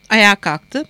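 plain reading voice

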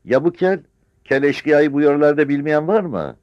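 very high, loud cry; challenging village woman. Acted. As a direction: shout the question over the crowd. (tiz, yüksek sesle soran yaşlı kadın)

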